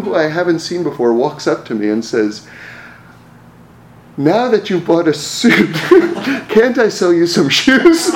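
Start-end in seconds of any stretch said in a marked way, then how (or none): 4.2-8.1 slow, higher and louder, breaking into laughter; can barely get it out for laughing; incredulous laughing retelling; laughing quote. Retold or imitated. Stranger's pitch, cracking up.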